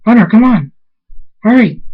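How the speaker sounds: high, loud panicked shouting; urgent scream. Acted. Niece rushing to rescue her aunt, screaming. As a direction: shout to rally help fast. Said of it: "Vinyl Scratch screams".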